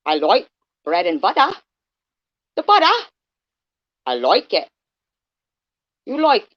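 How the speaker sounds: hammed-up Australian, "butter" rising, jumps up high; a playful, overdone Australian with sudden high squeaks; Australian accent. Shown as a demonstration. Streamer showing off Australian to chat, hammed up. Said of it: "I'm doing Australian".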